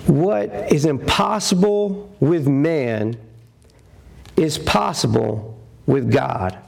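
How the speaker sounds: "What is impossible with man" drawn out slowly, weighty; slow reverent proclamation; slow, solemn quote. Shown as a demonstration. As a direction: deliver each word slow and grave, like scripture. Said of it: "That's what Jesus said".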